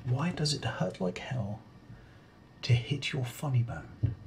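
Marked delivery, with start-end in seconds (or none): none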